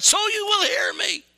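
high, full-force shouted answer; yelling. Retold or imitated. Preacher answering why he yells, emphatic yell. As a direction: shout to make sure they hear. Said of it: "Why do you yell?".

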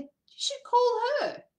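high, slow little inner voice; gentle inner voice. Retold or imitated. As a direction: let the tiny voice inside nudge you. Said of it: "this little voice said".

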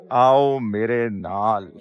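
usual speaking voice

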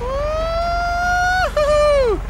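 long, high "woo-hoo-hoo"; gleeful whooping. Real, not acted. Streamer celebrating in the game, gleeful whooping. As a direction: stretch out a high, joyful whoop. Cheering during gameplay.

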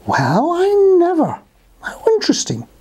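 high, arching exclamation of wonder; amazed rabbit. Acted. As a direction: act out intrigued surprise for children. Rabbit amazed by talking bubble wand.

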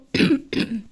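short breathy cough from a cold; sick cough. Real, not acted. Sick streamer coughing before playing. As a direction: cough it out, you're ill. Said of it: "O yüzden öksürüyorum".

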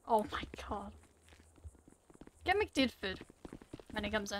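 whisper. Real, not acted. Exasperated aside.

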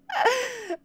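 high squeal of a laugh; a sudden real laugh; burst of laughter. Real, not acted. Streamer laughing at his own clumsy click, high squeal.

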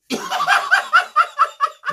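a squealing burst of laughter; high shrieking laugh. Real, not acted. Shrieking laugh after a joke.

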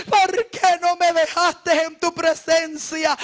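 tearful plea. Retold or imitated. Pleading with the Lord, crying.

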